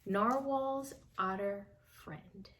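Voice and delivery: ordinary delivery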